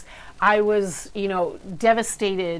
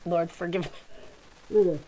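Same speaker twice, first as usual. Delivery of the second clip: choking on a drink. Real, not acted. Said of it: "Sorry, I'm choking on my coffee".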